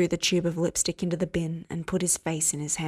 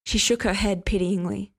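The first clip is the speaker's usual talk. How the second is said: ordinary delivery, nothing marked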